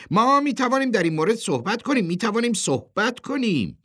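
high, loud, hurried appeal; hasty placating. A narrator's reading. Chairman hastily offers to talk with the boss, flustered. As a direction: rush to calm him down.

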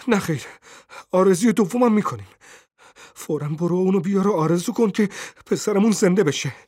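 high, urgent, exultant; triumphant cry. A narrator's reading. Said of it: "زن پیروزمندانه فریاد کشید". (پیروزمندانه و با فریاد)

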